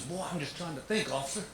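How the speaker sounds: dad's voice. Retold or imitated. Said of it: "not even disguising my voice".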